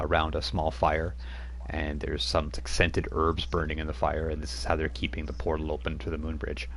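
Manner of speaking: ordinary talk, nothing marked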